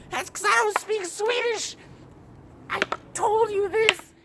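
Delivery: very high falsetto outburst; exasperated mock screech. Jogger to lady who scolds him, squealing in mock exasperation. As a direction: shoot way up into a squealed protest.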